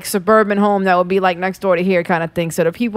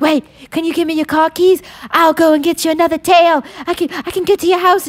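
higher-pitched imitation of an eager rescuer; urgent helper; 6 semitones higher than usual. Helper offering to fetch another tail, mock urgency. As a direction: act out a hurried offer of help.

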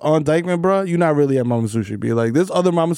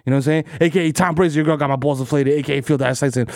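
normal talking voice

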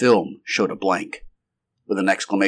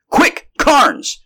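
urgent high-pitched shout; loud, high cry of alarm; 6 dB louder than usual. A narrator's reading. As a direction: shout to hurry a companion. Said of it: "shouted the doctor".

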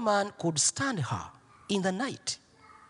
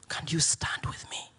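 whispered and much softer, pleading; whispered plea; 5 semitones lower, 6 dB quieter than usual. Retold or imitated. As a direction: repeat the plea as a hushed whisper.

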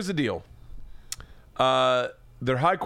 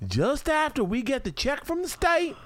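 hammed-up Southern accent; 12 semitones higher than usual. Host mocks wife reading meal plans in a Southern accent. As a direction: stretch each word in a Southern drawl. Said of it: "I wish I hadn't done it in that accent".

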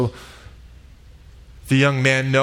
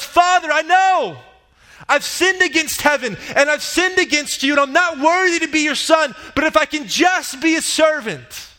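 yelling desperately; 12 semitones higher than usual. Retold or imitated. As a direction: shout it out to the father running toward him. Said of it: "And so he yells".

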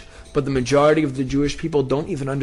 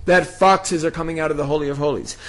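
ordinary delivery

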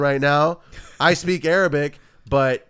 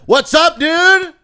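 much higher, louder forced greeting; 9 dB louder, much slower than usual. Retold or imitated. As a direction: call it out loud to force a reply. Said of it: "I'm like".

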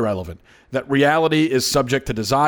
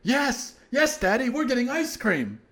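high, softer childlike mock voice; imitating little daughter; 9 semitones higher, 6 dB quieter than usual. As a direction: squeak it out like an eager three-year-old girl. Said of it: "She came in and she said".

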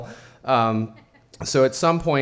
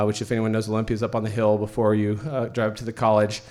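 ordinary delivery, nothing marked

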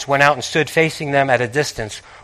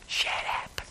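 whispered shut up; 20 dB quieter than usual. Shown as a demonstration. Elisha hushing the sons of the prophets in a whisper. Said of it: "Keep silent".

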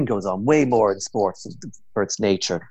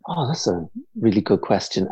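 ordinary delivery

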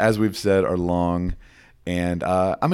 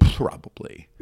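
unvoiced whispered word, a bit louder; 5 dB louder, slower than usual. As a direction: whisper it back. Echoed whisper back.

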